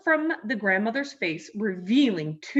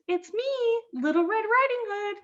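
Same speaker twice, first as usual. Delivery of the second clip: put-on wolf putting on a high-pitched little girl voice; 13 semitones higher than usual. A narrator's reading. Wolf fooling Grandmother at her door, fake little girl voice. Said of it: "in his best little girl voice".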